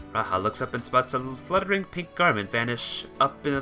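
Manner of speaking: ordinary delivery, nothing marked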